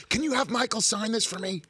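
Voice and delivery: high-pitched pleading fan voice; mock fan request, voice lifted high; imitated eager fan. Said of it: "They're like".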